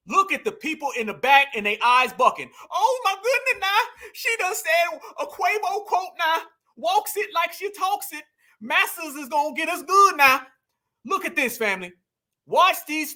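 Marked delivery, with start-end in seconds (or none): 2.7-10.5 high-pitched mock excitement; mocking crowd voice. Commentator mocking a crowd thrilled by a rapper quote, high mock-excited voice. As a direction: pitch it up, parodying a gushing devotee.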